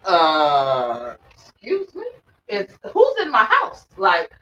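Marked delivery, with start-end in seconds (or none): 1.3-3.9 mock indignation. Retelling finding nephew at door.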